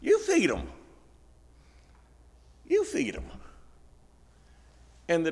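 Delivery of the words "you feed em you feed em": high, firm, unhurried command; Jesus's simple command. Retold or imitated. Jesus tells the disciples to feed the crowd: high, firm command. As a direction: hand the job straight back to them. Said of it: "Jesus looks at him and says".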